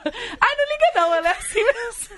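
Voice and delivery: smooth things over in a high squeaky voice; high apologetic imitation of Camila; imitating a friend. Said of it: "Ela fala".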